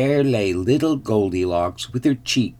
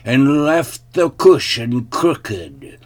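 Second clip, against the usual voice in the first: loud, slow, great big growl; growling father bear; 5 semitones higher, 6 dB louder, slower than usual. A narrator's reading. Father bear complains about his cushion, slow loud growl. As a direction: draw out the big bear's complaint. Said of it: "growled the father bear in his great big voice".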